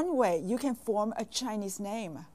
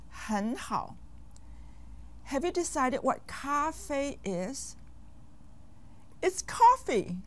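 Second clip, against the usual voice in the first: ordinary talk, nothing marked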